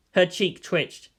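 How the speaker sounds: ordinary delivery, nothing marked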